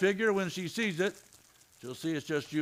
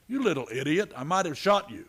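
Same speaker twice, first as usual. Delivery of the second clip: scolding; 5 semitones higher, 5 dB louder than usual. Acted. Matt scolds Narisha.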